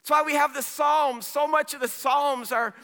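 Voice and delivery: high, loud pulpit cry; impassioned preaching. Acted. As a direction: preach it at full force, urgently. Preaches at full force.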